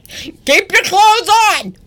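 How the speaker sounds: very high, loud, drawn-out shout; mock outraged yell. Co-host scolding the naked passenger, mock outraged yell. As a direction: holler it like a scandalized order.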